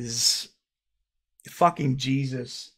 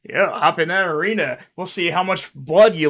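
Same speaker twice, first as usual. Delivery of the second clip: mocking commenter; 3 semitones higher, 7 dB louder than usual. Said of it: "comment and people saying".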